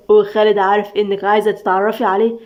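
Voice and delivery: ordinary delivery, nothing marked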